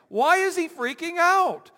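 high, loud and urgent; emphatic preaching. Real, not acted. Preacher pressing the congregation on Moses' panic. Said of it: "preacher at full force".

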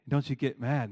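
normal talking voice

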